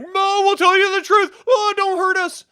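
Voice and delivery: high, loud whimpering plea; hammed-up frightened alien begging; mock panicked pleading. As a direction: beg like a terrified hostage. Aliens begging the brutal Jedi.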